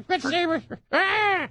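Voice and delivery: put-on high thin old lady scream; high granny shriek.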